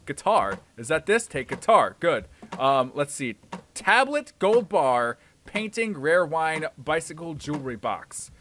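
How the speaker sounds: ordinary talk, nothing marked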